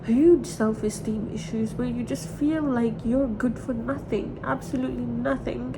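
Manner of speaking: ordinary talk, nothing marked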